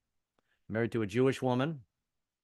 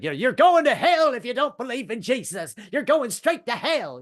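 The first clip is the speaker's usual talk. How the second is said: high, loud hellfire preaching; radio minister yelling at listeners; yelling preacher; 12 semitones higher, 8 dB louder than usual. Retold or imitated. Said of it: "a minister yelling at you".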